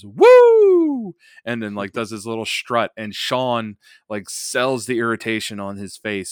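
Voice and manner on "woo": high, loud wrestling woo yell; Flair woo. Retold or imitated. Commentator imitating Flair's woo after the arm drag, loud. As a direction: belt out the signature woo. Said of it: "lets go and goes".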